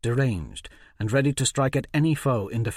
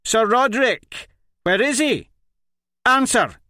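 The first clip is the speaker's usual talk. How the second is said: high, loud screaming demand; yelling; 13 semitones higher, 8 dB louder than usual. A narrator's reading. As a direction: bellow the questions at him. Interrogating the dazed Baron.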